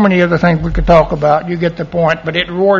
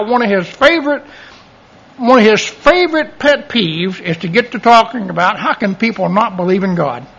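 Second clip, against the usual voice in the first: ordinary talk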